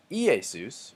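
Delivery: "Iesus" slowly with the opening I stressed, I-e-sus; wrong first-syllable stress. Shown as a demonstration. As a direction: punch the first vowel of "Iesus". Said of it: "But I'm not saying".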